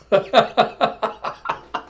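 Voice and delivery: high, breathy burst of laughter; real giggle. Real, not acted. Laughing at the acted battle cry, real giggle. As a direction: break into giggles.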